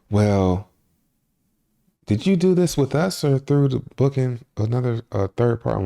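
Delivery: a hint of a prissy desk clerk asking; front desk clerk. Desk clerk quizzing the couple about booking, mock prim. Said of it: "she's like".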